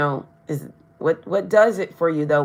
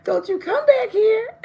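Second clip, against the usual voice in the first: laughing, much higher mock yell; half-laughing playful warning at the card. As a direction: shoo them off while laughing. Mock-shooing the pop-up person away.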